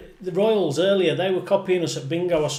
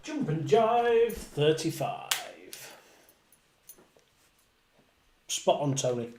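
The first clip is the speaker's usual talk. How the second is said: bingo caller; 3 semitones higher than usual. Acted. Calling bingo numbers with rhymes.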